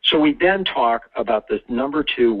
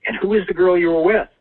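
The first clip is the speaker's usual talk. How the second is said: higher-pitched questioning priest; lightly acted priest, pitched up; 8 semitones higher, 4 dB louder than usual. Priest questions little Joey in confession, in a raised priest voice. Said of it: "the priest says".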